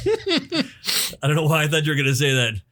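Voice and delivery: laughing through the words. Real, not acted. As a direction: giggle while saying it. Laughing while speaking.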